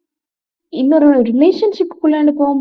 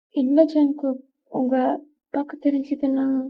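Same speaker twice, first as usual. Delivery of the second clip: hushed worried refusal; 6 dB quieter than usual. Acted. Girl refuses, fearing his father.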